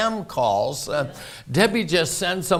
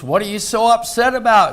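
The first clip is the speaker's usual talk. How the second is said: higher, louder, quick question; mock puzzlement in a raised voice; retold puzzled question; 5 semitones higher, 6 dB louder, faster than usual. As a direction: imitate a baffled husband quickly. Husband questions upset wife, retold.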